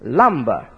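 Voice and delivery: "Lumber" said as LUM-buh, no hard r; "Lumber" stressed up front, falling low at the end; correct pronunciation. Shown as a demonstration. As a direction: let "Lumber" fall away on a weak "buh". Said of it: "the correct pronunciation of this word is the same".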